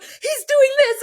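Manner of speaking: mock a driver whining on the radio; George's high-pitched voice. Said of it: "his, like, little high-pitched voice".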